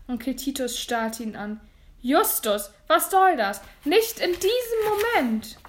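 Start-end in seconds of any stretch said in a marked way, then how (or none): 2.0-5.6 high, loud indignant outburst; shrieked protest, last words stretched; exasperated scolding. Acted. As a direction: scold him for interrupting. Uncle scolds nephew for interrupting.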